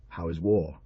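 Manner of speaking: usual speaking voice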